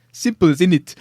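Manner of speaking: loud put-on African accent; mock African accent. Pitching an advert parody, loud African accent. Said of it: "like an African person".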